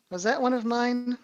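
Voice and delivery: ordinary delivery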